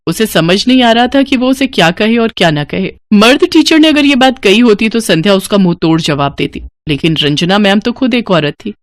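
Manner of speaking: ordinary delivery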